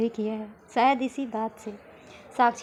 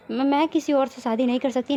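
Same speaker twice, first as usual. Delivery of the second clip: ordinary delivery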